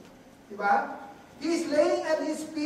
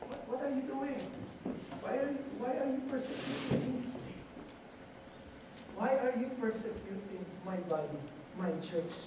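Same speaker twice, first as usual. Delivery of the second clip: hushed, halting question with long pauses; Lord's soft reproach; 2 semitones lower, 11 dB quieter than usual. Acted. Preacher voices the Lord asking Paul about His church, hushed. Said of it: "this is what the Lord is saying".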